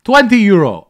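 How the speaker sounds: loud, high final offer; mock seller caving in; seller shouting. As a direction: call out the last price.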